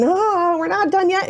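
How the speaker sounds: put-on high whiny child complaint; whining. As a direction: whine it like a tired kid. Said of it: "I would be whining".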